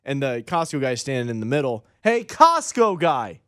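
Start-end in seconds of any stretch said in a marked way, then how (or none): 2.0-3.4 higher, drawn-out mock call; calling out to get someone's attention; imitated yell. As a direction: holler it slowly across a store, not full volume. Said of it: "the guy yelled it".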